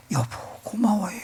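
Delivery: whispered thanks. Retold or imitated. Wife thanking husband in a whisper. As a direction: breathe the thanks softly.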